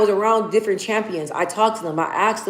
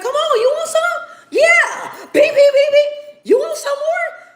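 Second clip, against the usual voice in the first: very high, shouted taunting; old school boxer taunt. Retold or imitated. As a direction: egg him on like a brawler. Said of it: "the old school fighters was like".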